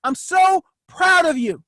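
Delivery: self-congratulation. Shown as a demonstration. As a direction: praise yourself like a proud coach. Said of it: "I congratulate myself all the time".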